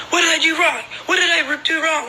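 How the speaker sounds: high, insistent mock yelling; imitated angry blow-up. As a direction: act out his outburst, held below a real shout. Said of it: "louder than that, like, way louder than that".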